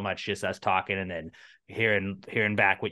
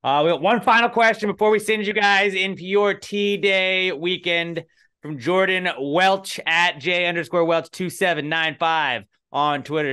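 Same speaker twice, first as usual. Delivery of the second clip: announcer voice; 9 semitones higher, 8 dB louder than usual. Acted. Announcing the final question.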